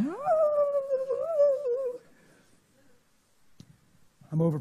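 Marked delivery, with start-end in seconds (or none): 0.1-2.0 long, very high-pitched "oh"; imitated high wail. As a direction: hold a mimicked wail way up high. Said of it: "She's like".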